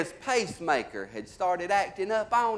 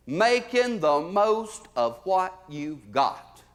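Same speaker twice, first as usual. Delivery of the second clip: slow motto; much slower than usual. Acted. First take of motto.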